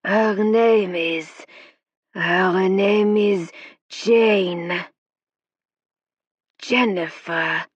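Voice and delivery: spirit guide speaking through the medium; slow foreign spirit growl. A narrator's reading. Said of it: "growly voice".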